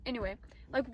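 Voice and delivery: normal talking voice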